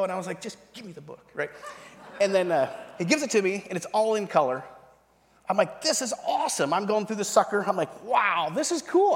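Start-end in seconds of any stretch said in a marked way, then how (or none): 0.4-1.2 very soft, retold impatient plea; impatient aside. Newcomer wants the offered Bible, hushed impatient aside. 5.8-6.7 high-pitched retold delight. He discovers a colour Bible, high excited delight. 8.0-9.1 high, slow stretched-out wonder; amazed. Retold or imitated. Leafing through a colour Bible, high drawn-out amazement.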